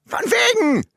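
spöttischer Ruf, viel höher, lauter; Ruf aus der Küche. Acted. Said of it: "seine Frau aus der Küche rufen". (laut rufende Ehefrau aus der Küche)

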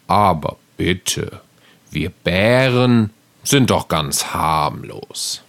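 low, slow, gentle bear. Acted. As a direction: rumble it like a harmless bear. Bear speaking.

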